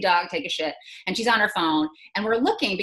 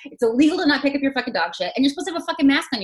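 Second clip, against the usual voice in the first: louder, heated scolding; retold yelling; 5 semitones higher, 3 dB louder than usual. Scolding the neighbor about dog mess, heated and loud. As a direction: scold her at full volume. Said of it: "she starts screaming at me".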